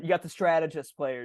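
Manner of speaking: ordinary talk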